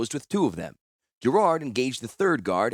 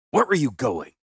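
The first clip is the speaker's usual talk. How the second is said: snapped; 3 semitones higher, 4 dB louder than usual. Acted. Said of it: "he snapped".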